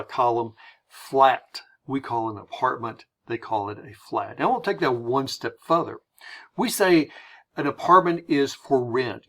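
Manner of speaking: ordinary talk, nothing marked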